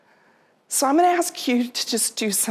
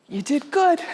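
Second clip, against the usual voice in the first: tender farewell; 5 semitones higher than usual. Retold or imitated. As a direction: ease her gently toward letting go. Farewell to dying mother.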